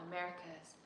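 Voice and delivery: ordinary talk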